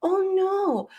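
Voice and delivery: high, slow imitation of a sweet old man; sweet old man on the phone, gently apologetic. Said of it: "he sounded like the sweetest old man".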